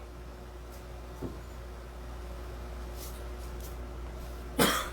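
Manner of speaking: real coughing fit; choking cough. Real, not acted. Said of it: "I'm choking".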